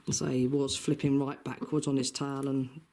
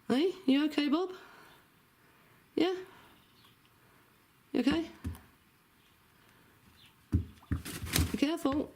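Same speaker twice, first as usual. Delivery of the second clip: worried coaxing, pitched way up; anxious pet talk; 13 semitones higher than usual. Real, not acted. Checking on pet chicken.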